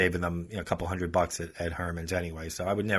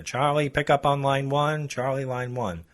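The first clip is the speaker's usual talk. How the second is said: higher, louder, slow overhead page; store loudspeaker page; 6 semitones higher, 3 dB louder than usual. Retold or imitated. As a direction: call it out like a store PA announcement. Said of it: "they announce on the speaker".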